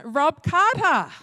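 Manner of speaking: loud, drawn-out announcer-style welcome; rousing introduction. Real, not acted. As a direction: belt out the name slowly to bring on applause. Introducing the speaker for applause.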